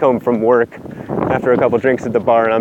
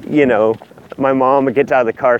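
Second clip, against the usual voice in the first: normal talking voice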